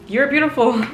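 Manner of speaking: mock awkward self-praise. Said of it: "I felt so weird".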